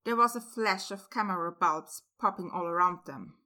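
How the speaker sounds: ordinary delivery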